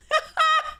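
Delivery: incredulous laugh. Real, not acted. Laughs at own misclick.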